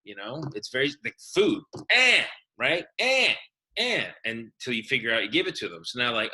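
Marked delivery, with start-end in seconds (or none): 1.9-2.2 put-on high, loud "eh" like a demanding baby; short high baby squawk; imitated baby noise. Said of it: "they make a sound with their mouth". 3.0-4.0 put-on high, insistent baby "eh"; repeated short squawks like a baby; imitated baby noise. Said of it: "they make a sound with their mouth".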